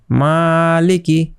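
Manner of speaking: মালিকি drawn out slowly, the long a held one beat; slow it right down and hold the a in মালিকি; one alif stretch. Shown as a demonstration. Teacher showing the class how to read মালিকি slowly. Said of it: "উপরেরটা কি বললাম".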